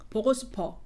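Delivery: even, standard Seoul intonation; Seoul dialect. Shown as a demonstration. Comparing dialects side by side, Seoul dialect. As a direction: say it simply in Seoul Korean. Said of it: "you hear the difference".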